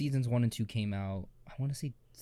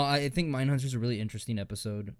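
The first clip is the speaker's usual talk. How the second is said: ordinary talk, nothing marked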